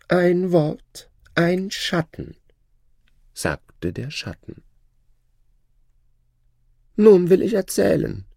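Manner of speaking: high, proud voice of a boastful shadow; shadow's voice. Acted. Shadow bragging to his old master, high voice. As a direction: lift the voice high and show off new wealth.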